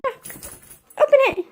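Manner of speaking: drawn-out high shout of open it; urging the dog on, high and loud; high coaxing call. Real, not acted. Owner coaxing her dog to open the door, high and drawn out.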